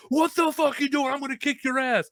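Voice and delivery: high-pitched rowdy challenge; retold angry threat. Drunk people confronting wrestlers, angry threat. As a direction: imitate belligerent drunks picking a fight.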